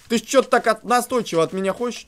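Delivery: ordinary delivery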